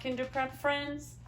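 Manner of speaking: usual speaking voice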